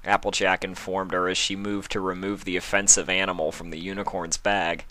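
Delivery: usual speaking voice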